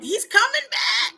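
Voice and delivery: high, stretched-out mock panic; frantic doomsayer cry. Lone person warning everybody, frantic mock cry. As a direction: wail the warning, slow and high. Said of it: "one person that's like".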